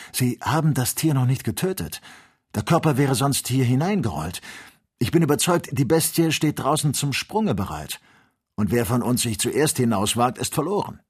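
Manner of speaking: urgent warning. A narrator's reading. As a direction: argue urgently against rushing out. Companion urgently warns the hunter. (aufgeregt und eindringlich warnend)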